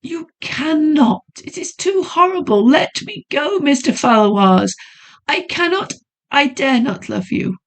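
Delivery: high, anguished lady; frantic young woman. Acted. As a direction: refuse him in despair. Heroine refuses his help.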